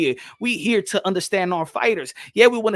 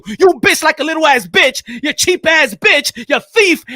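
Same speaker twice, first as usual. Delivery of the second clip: louder insulting rant; angry rant; 3 semitones higher, 7 dB louder than usual. Real, not acted. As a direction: curse them out hard. Ranting at the audience.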